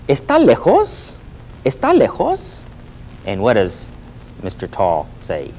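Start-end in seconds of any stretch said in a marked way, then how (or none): 0.1-2.6 high squeaky voice of a short cartoon man; high little-man voice asking if it is far; little man's voice. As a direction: pipe it up like a tiny worried man. Señor Bajo's high cartoon voice.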